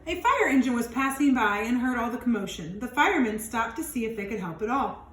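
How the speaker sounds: put-on lively read-aloud for young kids; expressive picture-book narration; storybook reading. A narrator's reading. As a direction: read it out like storytime for little ones. Reads picture book to children.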